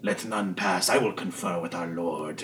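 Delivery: intoned cult leader voice; dark cult leader. Acted. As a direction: give a grave, quiet order. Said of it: "The leader goes".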